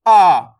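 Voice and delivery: "आ" held long with a loud push; long stressed aa. Shown as a demonstration. Teacher shows students the forceful vowel, "आ" long and stressed. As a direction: lean hard on "आ" as a drawn-out aa. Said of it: "उच्चारण में थोड़ा जोर लग रहा है".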